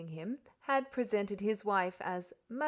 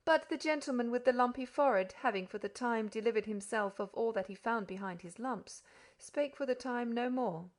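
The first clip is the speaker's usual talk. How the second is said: usual speaking voice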